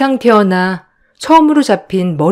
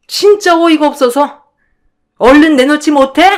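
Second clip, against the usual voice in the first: shrill, sneering, raised voice; higher, scornful accusing sister-in-law; angry accusation; 6 semitones higher than usual. Acted. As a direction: look down on her and demand it back. Sister-in-law accusing her of theft.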